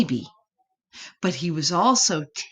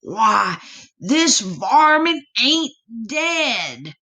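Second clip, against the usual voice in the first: lightly voiced buzzard, a touch higher and slow; a hint of a cranky old bird squawking; 4 semitones higher, 4 dB louder, slower than usual. Buzzard finding the possum alive, mild squawk. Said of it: "it squawked".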